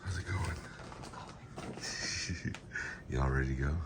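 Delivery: soft unvoiced whisper. Real, not acted. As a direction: whisper so nobody wakes. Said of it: "Why are you whispering?".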